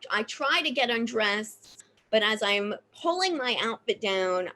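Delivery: usual speaking voice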